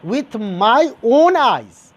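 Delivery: very slow, "my" and "own" stretched out; drawn-out, forceful "my own eyes"; emphatic stress. Shown as a demonstration. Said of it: "you are giving a stress".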